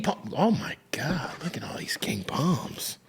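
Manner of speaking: hushed, breathy awe at the palms; whisper. Real, not acted. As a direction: whisper it like a secret treasure.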